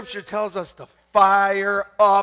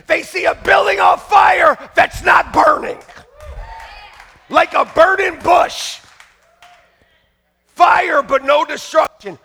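loud, raised preaching voice; fiery preaching; 4 semitones higher, 5 dB louder than usual. Acted. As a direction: paint the burning church for them. Preaching at full force.

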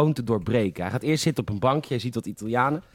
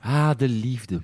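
dreamy sigh; much slower than usual. Acted. Sighing about romance in film.

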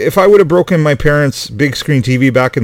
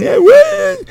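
high mock whine of a kid griping; whiny kid bitching; 3 dB louder than usual. Dad imitating son whining to friends, mock whine.

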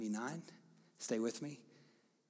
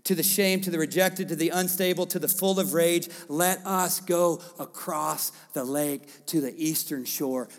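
preaching cadence; 4 semitones higher, 6 dB louder than usual. Acted. Pastor listing the outcasts to the church, preaching. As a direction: punch each phrase a bit louder.

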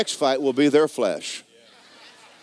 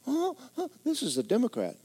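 mock alarm; 12 semitones higher, 4 dB quieter than usual. Mocking political churchgoers.

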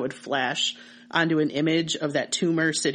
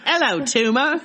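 mock British accent; 7 semitones higher, 4 dB louder than usual. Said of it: "That's my British accent".

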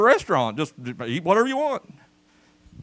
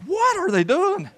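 baffled; 13 semitones higher, slower than usual. Pretend. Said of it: "You'd be like".

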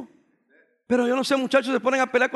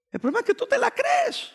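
high mocking jab. Preacher mocking youth self-image.

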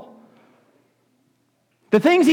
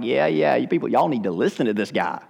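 lower, softer, casual; a relaxed aside urging them to listen; laid-back Nicodemus; 8 semitones lower, 4 dB quieter than usual. Acted. Nicodemus telling the other leaders to listen, lower and casual. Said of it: "Nicodemus is sitting there like".

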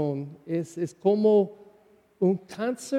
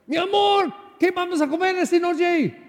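much higher, louder calling out; shouting; 11 semitones higher, 4 dB louder than usual. Pretend. Priest mimicking a spouse shouting about dinner, much higher and louder. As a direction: yell across the house to your love about dinner.

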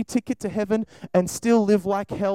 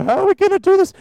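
higher and louder panic; panicked soul; 9 semitones higher, 7 dB louder, faster than usual. Pretend. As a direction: fret out loud, voice jumping up. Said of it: "my soul would start to go".

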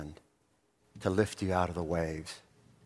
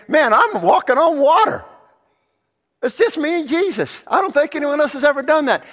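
much higher, much louder giddy boast; Peter boasting; 14 dB louder than usual. Acted. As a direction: crow like a thrilled show-off. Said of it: "I mean, he's there".

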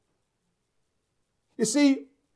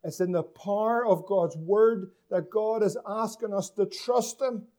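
ordinary delivery

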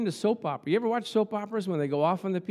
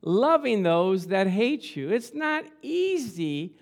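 booming motivational speech; 4 semitones higher than usual. Acted. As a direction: preach it, drawing out each phrase. Preaching at full force.

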